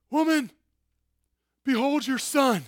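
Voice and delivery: high, loud, strained cry, as if gasping on the cross; a dying man pushing up to speak; straining for breath. Acted. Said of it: "pushing up to get enough breath to say".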